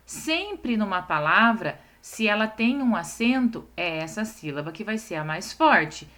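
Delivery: normal talking voice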